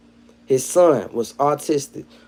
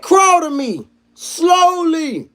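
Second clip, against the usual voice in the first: much higher, loud commanding cop; cop yelling; 4 dB louder, much slower than usual. Retold or imitated. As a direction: order him down like a cop. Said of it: "He said".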